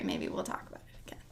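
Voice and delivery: fast whisper; quick under-breath whisper; whispered aside. Pretend. Teasing aside.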